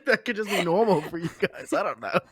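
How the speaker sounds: high-pitched, laughing as they speak; amused giggling. Real, not acted. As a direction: tease a friend while still getting over the giggles. Still giggling at co-caster's odd phrase.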